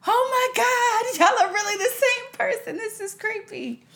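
high, loud astonished voice; high surprised voice. Retold or imitated. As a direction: gasp it out in disbelief. Doctor quoted, high loud.

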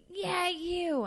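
mock brassy star voice; imitating Roseanne's call. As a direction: point someone out across a room. Roseanne singling her out.

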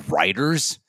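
usual speaking voice

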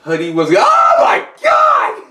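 rising into a high, slow shout; mock horrified gasp; shocked parent. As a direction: catch yourself in horror. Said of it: "and just be like".

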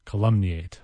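ordinary delivery

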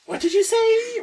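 loud, very high meme squawk; mock incredulous. Friend pressing after a "nothing", loud high mock incredulity. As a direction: blurt it high and loud, half laughing. Repeats meme catchphrase laughing.

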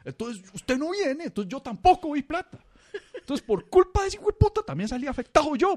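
high, loud, ranting barber; hammed-up furious barber; mock outrage. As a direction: rant like a barber gone crazy. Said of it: "se vuelve loco y empieza".

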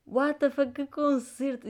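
high, loud disbelieving exclamation; excited. Real, not acted. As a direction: rave with amazed disbelief. Raving about the concert.

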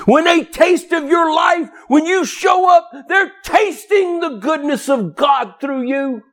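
fiery pulpit shout; full-force preaching. Acted. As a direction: drive it into the congregation.

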